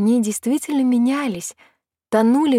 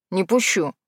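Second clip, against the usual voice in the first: understated firm refusal; resolute; 2 semitones lower than usual. A narrator's reading. Hin blocks his sister's way, resolute. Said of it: "он решительно заявил".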